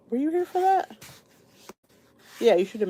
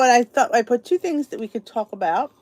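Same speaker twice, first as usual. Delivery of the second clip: ordinary delivery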